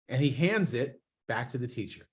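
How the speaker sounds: ordinary talk, nothing marked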